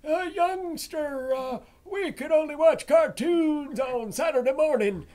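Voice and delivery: high, loud put-on old man voice; hammed-up old geezer complaining about the old days; mock old-timer. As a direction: ham up a grumpy old-timer remembering.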